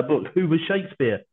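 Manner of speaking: ordinary talk, nothing marked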